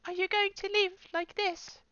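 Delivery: high, slow tearful plea; crying woman asks softly. Acted. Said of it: "still tears streaming down her face".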